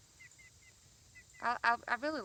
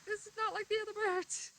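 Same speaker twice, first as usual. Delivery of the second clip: dog's surprised voice; 14 semitones higher, 7 dB louder than usual. Retold or imitated. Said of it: "He's like".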